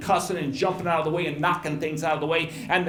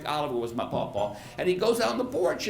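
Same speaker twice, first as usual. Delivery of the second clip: ordinary talk, nothing marked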